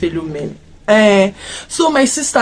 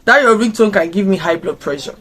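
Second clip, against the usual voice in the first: ordinary talk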